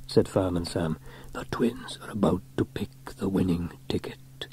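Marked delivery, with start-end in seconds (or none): 1.3-4.5 breath-only whispered aside; hushed whisper. A narrator's reading. Sam telling Trevor the draw is starting, whispering. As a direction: whisper so as not to disturb.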